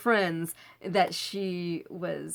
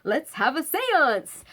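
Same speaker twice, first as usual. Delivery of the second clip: put-on teen suggestion; 7 semitones higher, 6 dB louder, slower than usual. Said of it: "what most fourteen-year-old kids do".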